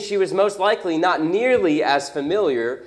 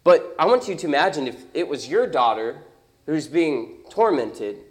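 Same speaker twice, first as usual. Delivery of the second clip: ordinary delivery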